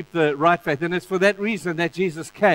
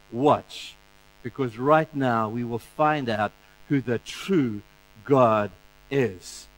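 lower, slow and solemn; solemn Elijah; 6 semitones lower, slower than usual. Retold or imitated. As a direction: let each word land with weight. Elijah before the fire.

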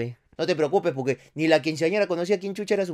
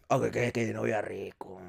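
lower, softer, slow savouring murmur of qué novia rico; 7 semitones lower, 10 dB quieter than usual. Shown as a demonstration. Host tasting stolen cake, murmuring with relish. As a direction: drag it out low as if tasting it. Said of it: "repeat savoured".

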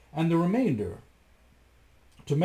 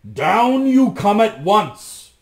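much louder, slow, furious bellow; shouting; 9 semitones higher, 14 dB louder, slower than usual. A narrator's reading. Bluebeard shouting his wife down. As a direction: roar it out slowly. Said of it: "Bluebeard was shouting".

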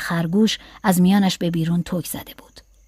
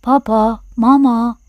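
talking doll; 3 semitones higher, 4 dB louder, slower than usual. A narrator's reading. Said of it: "و عروسک گفت".

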